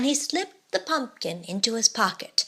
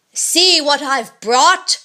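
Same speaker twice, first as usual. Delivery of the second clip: louder, slow, booming boast; dreadful giant voice; 4 semitones higher, 5 dB louder, slower than usual. Acted. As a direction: boom it out like a gloating giant. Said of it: "cried the giant in a dreadful voice".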